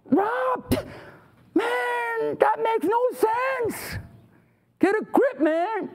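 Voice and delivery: very high, drawn-out protest; hammed-up exasperated heckler; incredulous objection. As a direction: protest in put-on disbelief. Acting listener objecting to preacher.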